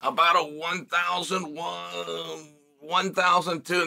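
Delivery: counting the seconds out slowly. Shown as a demonstration. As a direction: drag out each number like a stopwatch. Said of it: "could probably be done in about two seconds".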